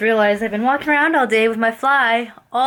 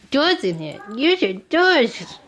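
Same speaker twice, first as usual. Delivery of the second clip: puppy voice; 5 semitones higher, much slower than usual. Real, not acted. Owner answering for her puppy in high baby talk. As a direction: coo it up high to the dog. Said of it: "my puppy voice".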